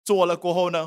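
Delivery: ordinary talk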